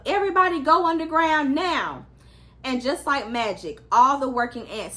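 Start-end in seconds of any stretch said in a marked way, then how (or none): 0.1-2.1 urgent yell, higher and louder. A narrator's reading. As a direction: shout the order to hide.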